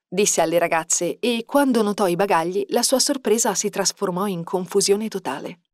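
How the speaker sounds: ordinary delivery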